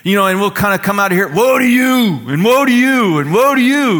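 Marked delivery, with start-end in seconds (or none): 1.3-4.0 loud, high, sing-out condemnation; mock angry denouncing. Frustrated believers copying Jesus, hammed-up angry shouting. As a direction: hurl it at one person after another. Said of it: "pent up anger and bitterness and resentment".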